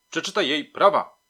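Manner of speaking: forceful police command; shouted order. A narrator's reading. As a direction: yell it at your subordinate. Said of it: "krzyknął do mnie".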